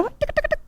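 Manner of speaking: quick soft tongue clicks; rapid ticking sound effect; web-tying ticks. Acted. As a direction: tick away softly as the web winds. Imitating web being tied.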